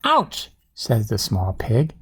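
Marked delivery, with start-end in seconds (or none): 0.0-0.5 very high pained little cry; startled ouch. A narrator's reading. Small pig bumped on the nose, startled ouch. Dragonfly bumps pig's nose.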